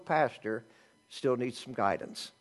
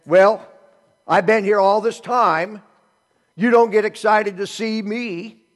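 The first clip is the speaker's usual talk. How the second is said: loud, slow, aggrieved; elder son resenting the father, loud and slow; resentful complaint; 5 semitones higher, 11 dB louder, slower than usual. Retold or imitated. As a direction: spit out the grievance of being overlooked. Elder son's resentment voiced.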